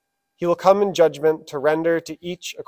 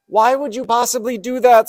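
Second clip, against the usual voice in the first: high, loud cry of betrayal; a dying frog screaming at the scorpion who stung him; frog's scream; 7 semitones higher, 4 dB louder than usual. Acted. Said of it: "screamed the dying frog".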